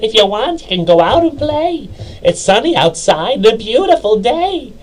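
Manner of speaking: high voiced mother calling her son outside; mother's voice. Mother bursts into son's room.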